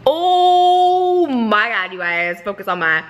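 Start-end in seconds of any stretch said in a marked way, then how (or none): 0.1-1.9 long, drawn-out high, loud exclamation; awestruck gush. Real, not acted. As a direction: gasp of wonder stretched right out. Awestruck by a favourite singer.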